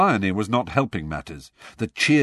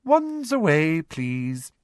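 high, sickly sweet command; sugary Umbridge voice; 8 semitones higher, much slower than usual. A narrator's reading. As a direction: give the order with falsely sweet politeness. Umbridge gives an order sweetly.

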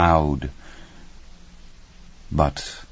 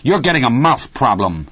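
raised-pitch stern reproach; angry scolding; 6 semitones higher, 4 dB louder than usual. A narrator's reading. Hal to Dennis against the door, angry scolding. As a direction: tell him off, voice rising.

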